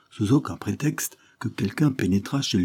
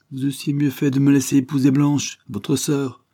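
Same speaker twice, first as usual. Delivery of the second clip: sneering; 6 semitones higher, 4 dB louder than usual. Acted. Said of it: "répliqua-t-il en ricanant".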